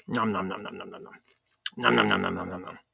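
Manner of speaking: eating while talking; chewing through the words; mouth full. Real, not acted. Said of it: "while I'm eating and talking".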